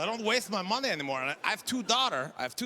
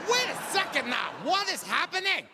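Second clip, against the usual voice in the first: much higher, incredulous shout; baffled outrage; 9 semitones higher than usual. Retold or imitated. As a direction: demand answers in total disbelief. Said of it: "I'm like".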